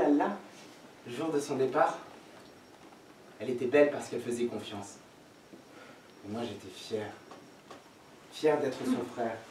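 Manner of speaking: bas, doux, plus grave; évoque la sœur tout bas; souvenir tendre. Acted. (souvenir tendre à voix basse)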